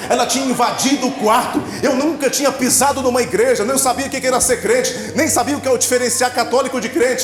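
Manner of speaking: fervent preaching. Acted. Testimony rising to preaching climax.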